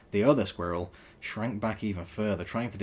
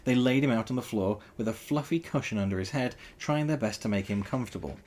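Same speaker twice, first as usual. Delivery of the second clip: usual speaking voice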